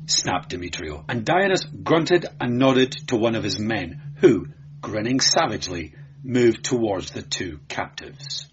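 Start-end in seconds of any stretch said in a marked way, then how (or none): none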